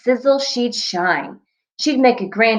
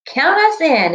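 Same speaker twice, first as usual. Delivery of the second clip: eager, raised-voice exclamation; exclaiming; 6 semitones higher, 3 dB louder than usual. Acted. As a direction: jump in eagerly, a bit louder and higher. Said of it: "exclaimed Seven".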